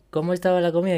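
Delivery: waiter voice. Retold or imitated. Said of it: "te dice el tío en inglés".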